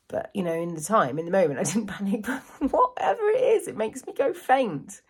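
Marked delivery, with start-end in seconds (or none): 2.3-5.0 laughing. Real, not acted. Laughing at herself.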